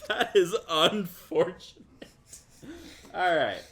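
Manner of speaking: amused, drawn out, laughing through it. Real, not acted. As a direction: say it while still laughing. Laughs at partner's delayed cheer.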